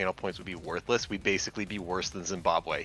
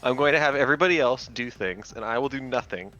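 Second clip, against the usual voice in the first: higher-pitched mocking impression of a streamer; mock streamer imitation; 4 semitones higher than usual. Co-host mocks the streamer about channel points, mock imitation. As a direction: mimic the streamer's plea, pitched up. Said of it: "Yeah, it's like".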